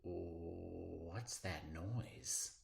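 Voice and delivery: very slow, soft, fearful; hushed scared question; scared whisper. Acted. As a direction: ask into the dark, frightened. Kid woken by noise.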